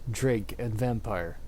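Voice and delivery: normal talking voice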